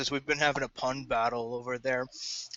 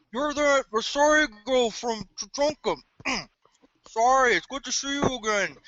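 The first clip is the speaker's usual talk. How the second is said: mouth full; 8 semitones higher than usual. Acted. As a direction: talk around a mouthful of food. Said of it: "with a mouthful of cheesy poofs".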